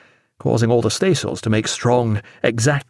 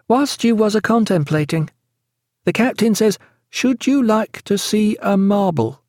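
high-pitched character voice for Sarah; high, even-toned storybook character; Sarah's voice; 10 semitones higher than usual. A narrator's reading. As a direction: speak up high, well above the narration.

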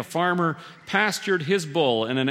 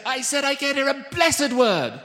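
high, exasperated British woman's holler; indignant British lady shouting down a bad line; thick British accent; 9 semitones higher than usual. A narrator's reading. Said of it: "which was a woman's voice".

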